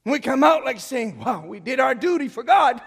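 loud, high preacher's shout; full-force preaching. Real, not acted. Rebuking the congregation over one hour a week, shouted preaching. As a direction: cry it out to the whole church.

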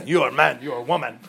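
blunt caveman voice. Shown as a demonstration. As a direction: grunt it out like a caveman. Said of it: "like a caveman".

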